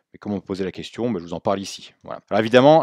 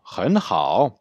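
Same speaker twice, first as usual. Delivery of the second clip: "Ren hao" with REN only climbing, HAO dipping; rising REN, falling-rising HAO; ren starts higher, rises; 3 dB louder than usual. Shown as a demonstration. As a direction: lift REN upward before HAO. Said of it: "le ren part plus haut".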